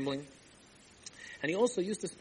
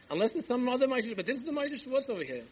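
high-pitched, baffled townsman; incredulous protest; 10 semitones higher than usual. Acted. Townsfolk answer a stranger seeking their drunkard, incredulous. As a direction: pitch it up in disbelief.

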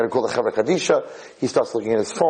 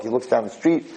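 ordinary talk

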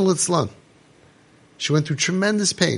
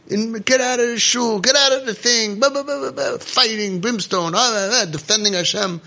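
high-pitched blustering imitation; mocking zealot rant; 6 semitones higher than usual. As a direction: rattle off a mock fire-and-brimstone tirade. Mimicking zealots ranting at people.

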